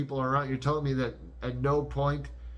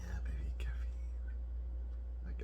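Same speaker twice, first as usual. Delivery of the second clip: whisper; 8 semitones lower, 12 dB quieter, much slower than usual. Real, not acted. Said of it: "muttering the quote trying to recall".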